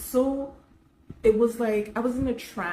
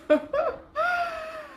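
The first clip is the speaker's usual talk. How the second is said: high, shrieking laugh; real high laughing fit; burst of laughter. Real, not acted. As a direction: crack up helplessly. Bursts out laughing before story.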